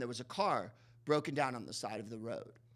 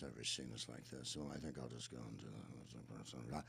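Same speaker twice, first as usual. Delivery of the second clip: put-on much lower and softer, a drunk man holding it together; drunk Dana Andrews; 12 semitones lower, 15 dB quieter than usual. Imitating Dana Andrews as a TV host drinking, low and soft. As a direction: murmur it low like a composed drunk. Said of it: "that's how Dana Andrews in the movie is".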